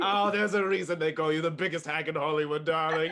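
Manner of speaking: loud, laughing, haughty critic; snooty critic. Acted. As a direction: toss off a grand insult with a laugh. Critic mock insult.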